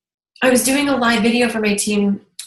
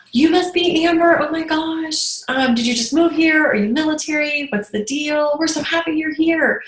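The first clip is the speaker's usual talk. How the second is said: gushing welcome; 8 semitones higher than usual. Retold or imitated. Hostess greeting a newcomer, high excited gush.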